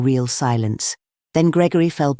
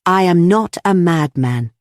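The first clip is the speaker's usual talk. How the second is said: slow, louder insistence on NOT; frightful sincerity, stress on NOT; 4 semitones higher, 4 dB louder than usual. A narrator's reading. Gregory reiterating to the room, sincere stress on NOT. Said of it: "with a frightful sincerity".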